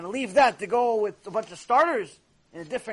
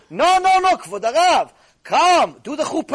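louder, eager insisting; insistent host; 6 semitones higher, 9 dB louder than usual. Retold or imitated. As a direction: urge him up to the chuppah. Host urging humble rabbi.

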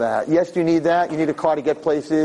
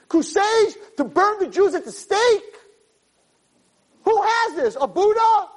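very high, strained-pitch rhetorical questions; impassioned preaching. Real, not acted. Preacher asks listeners what other faiths have to compare, impassioned preaching. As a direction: challenge the crowd at a fervent high pitch.

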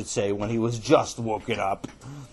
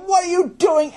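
very high, loud yell; furious outburst; 8 dB louder than usual. Acted. Boy losing his temper at his brother, high furious yell. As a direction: explode in high-pitched outrage.

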